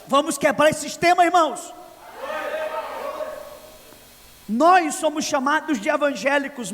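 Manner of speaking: loud, high preaching proclamation; full-force preaching. Real, not acted. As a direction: proclaim it to the whole church. Preacher exhorting congregation. (pregação a plenos pulmões)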